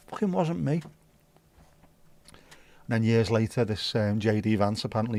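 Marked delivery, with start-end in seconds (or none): 0.1-0.8 higher indignant denial; mock indignant denial. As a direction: deny it hotly. Denying to coworker.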